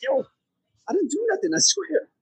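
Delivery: high-pitched pleading of innocence; mock protest. Foreigner on the bus when everybody's looking at him, high mock protest. Acting out a wrongly blamed passenger.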